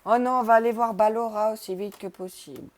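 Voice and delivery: loud, high panicked rush; urgent alarm in the voice. Real, not acted. Panicked streamer in horror game.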